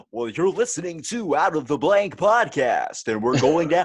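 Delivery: loud, high, drawn-out radio announcer. Acted. Podcaster opening the show, mock booming radio voice. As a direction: belt out a mock podcast intro. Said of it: "They'll do the radio voice".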